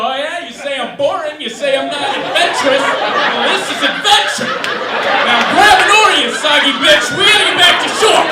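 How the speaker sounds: high, loud argumentative shouting, building; hammed-up bellowed comeback in a couple's fight; yelling back. Boyfriend in rowboat to soggy girlfriend, yelling back. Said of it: "I'd be yelling back at her".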